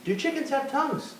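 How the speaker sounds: high squeaky voice. Pretend. Voicing the chicken bit.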